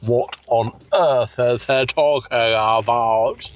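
ordinary talk

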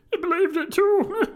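shrieked high and loud; crying out mirthfully. Acted. Nephew marvelling at his uncle to friends, very high loud cry. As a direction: squeal it out in hammed-up merriment. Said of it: "cried Scrooge's nephew".

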